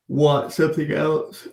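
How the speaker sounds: slow yawning talk. Real, not acted. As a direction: yawn right through the words. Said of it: "Shouldn't be yawning".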